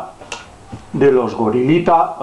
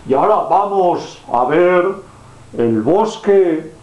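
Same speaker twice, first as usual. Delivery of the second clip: put-on reading to a toddler; 3 semitones higher than usual. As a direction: draw out the words for a little child. Storyteller to a child.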